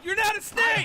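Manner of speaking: high, yelled protest; exasperated shout. Real, not acted. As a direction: yell it at him, fed up. Exasperated friend yelling at joker.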